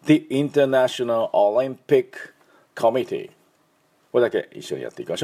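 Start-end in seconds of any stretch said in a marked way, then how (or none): 0.1-3.3 The International Olympic Committee said slowly, word by word; slow model phrase. Shown as a demonstration. Teacher modelling the full name slowly for repetition. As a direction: spell out the name slowly for learners. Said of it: "じゃあもう一回だけ。".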